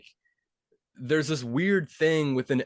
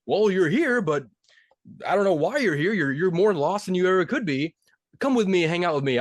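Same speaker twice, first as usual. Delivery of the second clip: higher, lightly loud kindly Santa; 6 semitones higher, 3 dB louder than usual. Retold or imitated. Santa inviting the lost penguin along, higher. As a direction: offer it a home, Santa-style. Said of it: "And he's like".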